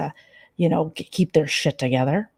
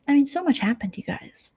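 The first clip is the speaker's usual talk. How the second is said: ordinary delivery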